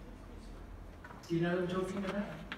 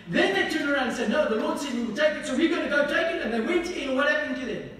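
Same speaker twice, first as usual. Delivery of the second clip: raised, louder preaching voice that drives each point home; fiery preaching; 5 semitones higher, 6 dB louder than usual. Acted. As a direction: preach it out loud to the congregation. Preaching at full force.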